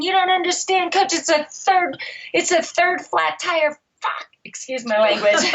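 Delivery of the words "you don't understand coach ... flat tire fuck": imitated yelling. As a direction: retell a racer's frustrated, sweary protest. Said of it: "he's yelling and he's swearing".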